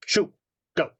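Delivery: mild booming command, words set apart; booming professor. Acted. Professor shooing students away from the first years, booming. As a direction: order them off with a lightly boomed voice. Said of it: "his voice booms, goes".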